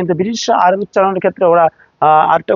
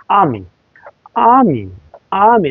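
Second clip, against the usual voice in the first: আর্মি as long aa-mi, British silent R; British R-less army. Shown as a demonstration. English teacher models British army, silent R. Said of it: "ব্রিটিশরা উচ্চারণ করে আর্মি".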